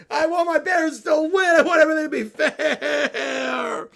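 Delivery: shouting tantrum. Acted. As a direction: scream it at her like a kid refusing. Bear rages at mom.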